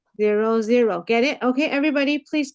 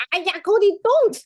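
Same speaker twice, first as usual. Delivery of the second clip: sharp protest; 7 semitones higher than usual. Real, not acted. Teacher, interrupted mid-lesson, yelps at the dog. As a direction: snap out a startled stop.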